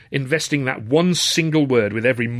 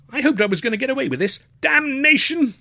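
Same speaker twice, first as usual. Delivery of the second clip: high, fast, upper-class student voice; student caught in a frock, complaining to the porter; flustered young toff; 4 dB louder than usual. Acted. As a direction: rush it out, cursing your luck. Plays caught student Luscombe.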